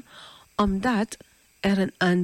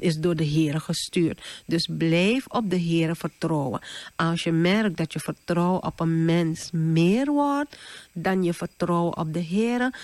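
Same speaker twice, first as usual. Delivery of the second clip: usual speaking voice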